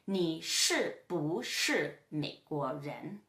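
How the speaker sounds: very slow, low Mandarin example; slow model question. Shown as a demonstration. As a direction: space out each syllable for learners. Teacher models a Chinese example question.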